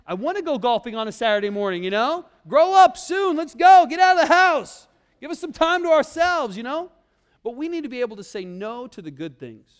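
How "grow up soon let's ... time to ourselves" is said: loud, high mock plea to the kids; joking shout. Father jokingly urging his little kids to grow up, loud mock plea. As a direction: shout it like an eager dad.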